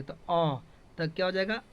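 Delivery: ordinary talk, nothing marked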